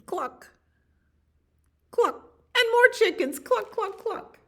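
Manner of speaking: very high, loud hen voice; chicken clucking. Acted. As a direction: cluck and squawk like excited hens.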